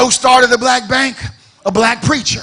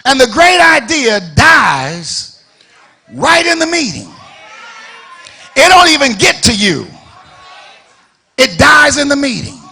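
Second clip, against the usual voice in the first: louder, higher, drawn-out preaching shout; full-force preaching; 4 semitones higher, 5 dB louder than usual. Real, not acted. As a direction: hammer it home from the pulpit.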